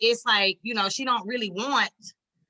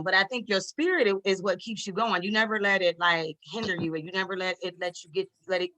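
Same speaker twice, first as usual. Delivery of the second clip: ordinary talk, nothing marked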